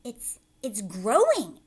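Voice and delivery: loud, slow astonished stammer; stunned cry. Acted. Aunt Spiker crying out the peach grows. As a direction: stammer in disbelief. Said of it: "Aunt Spiker cried".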